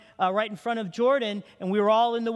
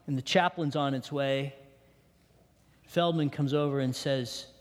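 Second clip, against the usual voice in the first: ordinary talk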